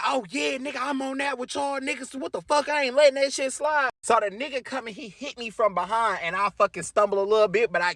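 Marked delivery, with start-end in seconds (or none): 0.0-3.9 higher, hyped-up street guy joining in; a random guy hyping himself into the brawl. Retold or imitated. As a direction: play the guy eager to jump into the fight. Guy jumping into street fight.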